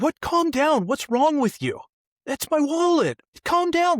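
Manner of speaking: high, loud, flustered grown-up; hammed-up panic over a runaway wallet; frantic adult. Adult chases his floating wallet, high and frantic. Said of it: "And they're like".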